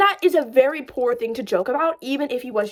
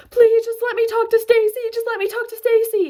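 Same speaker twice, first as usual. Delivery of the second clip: higher, louder hammed-up pleading; mock crying plea; 10 semitones higher, 4 dB louder than usual. Imitating the fired coworker begging to see Stacy, mock sob. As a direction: whine it like a begging fired man. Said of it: "And he's, like, crying".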